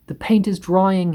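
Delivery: high, whining moan. A narrator's reading. Centipede moaning about the drying paint, high and whiny. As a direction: complain in misery. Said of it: "he moaned".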